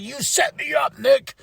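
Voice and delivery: half-hearted angry yell at the betrayer; mock angry shout. [name] yelling at Nick for the setup, mock angry. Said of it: "[name]'s angry".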